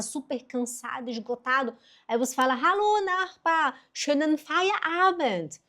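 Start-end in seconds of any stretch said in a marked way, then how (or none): 2.5-5.6 high sing-song German greeting; slow, high-pitched hello; greeting a neighbour. Acted. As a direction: call out to the neighbour, drawn out and high. Acted cheerful greeting to neighbour.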